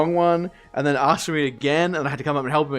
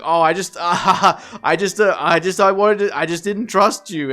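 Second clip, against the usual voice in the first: higher, louder flustered excuse with a laugh; stammering, awkwardly laughing mock apology; mock nervous; 5 semitones higher, 6 dB louder than usual. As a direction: imitate a nervous customer stumbling over excuses. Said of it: "You're a little nervous".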